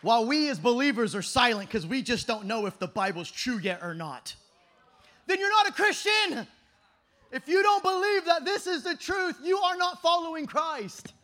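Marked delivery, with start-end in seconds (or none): 5.3-11.1 loud, high-pitched preacher's challenge; full-force preaching. Real, not acted. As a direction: confront the crowd, loud and high.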